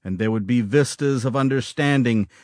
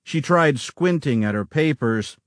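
plain reading voice

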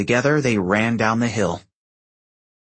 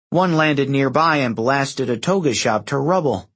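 plain reading voice

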